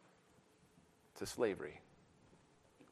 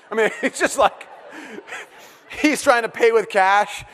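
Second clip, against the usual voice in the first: laughing; 8 semitones higher, 4 dB louder than usual. Real, not acted.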